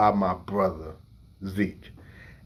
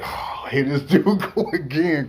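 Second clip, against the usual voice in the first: exasperated sigh, then laughing dismissal; annoyed brother; 5 semitones higher than usual. Retold or imitated. Zeke brushing off his crying little brother with a laugh. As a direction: roll your eyes at the crybaby again. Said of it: "Zeke like".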